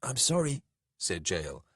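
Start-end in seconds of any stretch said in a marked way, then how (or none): 0.0-0.6 high, wavering apology. A narrator's reading. Jael apologising to the stern axeman, voice wavering. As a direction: apologise with an unsteady voice. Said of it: "voice wavering".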